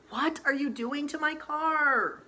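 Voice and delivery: high, loud outraged yell; alarmed cry. Acted. Jogger girl yells at Allie grinding her car's gears, high and loud. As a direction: yell it in horror at the abuse. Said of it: "cried the jogger".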